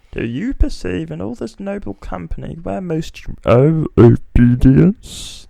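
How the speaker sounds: put-on high, strained old-man voice; father Brabantio summons his daughter. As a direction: play the old father demanding an answer.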